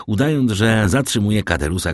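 usual speaking voice

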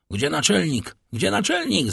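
mildly acted desperate cry; desperate call. A narrator's reading. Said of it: "zawołał".

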